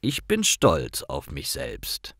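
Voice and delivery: normal talking voice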